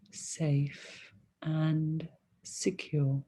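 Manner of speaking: ordinary delivery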